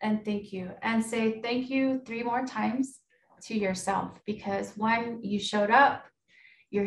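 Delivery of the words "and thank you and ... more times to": right in your ear. Acted. Guided breathing intention.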